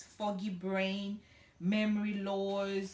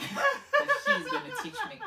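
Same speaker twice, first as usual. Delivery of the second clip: laughed out, much higher and louder; laughing; 5 dB louder than usual. Real, not acted. Host teasing herself about English lessons, laughing. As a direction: giggle the words through the joke.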